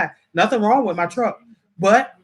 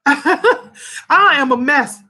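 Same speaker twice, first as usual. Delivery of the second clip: higher, louder, laughing at yourself; 5 semitones higher, 4 dB louder, much slower than usual. Real, not acted. Woman telling viewers she is a mess from crying, laughing. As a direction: laugh through it, voice pitched high. Laughs at own tearful state.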